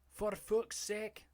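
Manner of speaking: high, drawn-out put-on voice; mock exasperation. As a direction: draw the curse out in a silly high voice. Repeats the exasperated quote.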